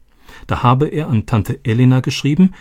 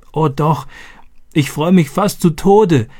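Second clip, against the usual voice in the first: laughing into sobs; 7 semitones higher than usual. A narrator's reading. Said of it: "japste sie und lachte und weinte gleichzeitig".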